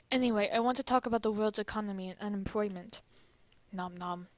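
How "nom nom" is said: put-on Chinese accent. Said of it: "that was still in the accent".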